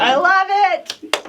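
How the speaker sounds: high, loud, slow gush of delight. Real, not acted. Thrilled over the gift mugs the co-host hands her.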